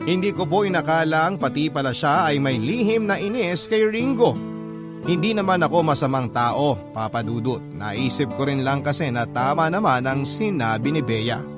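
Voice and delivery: usual speaking voice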